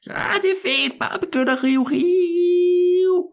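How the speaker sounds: put-on very high, thin old man's call from afar; distant high-pitched shout; far-off voice. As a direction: holler back across the maze, stretching the last word. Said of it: "Så kunne han høre i det fjerne".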